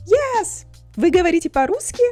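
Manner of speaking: ordinary talk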